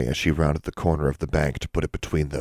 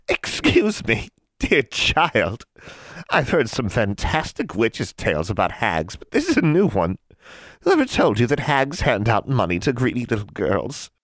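high, slow, mocking witch voice; cackling hag; 7 semitones higher than usual. A narrator's reading. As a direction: sneer it like an amused old crone.

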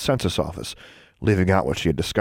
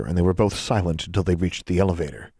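usual speaking voice

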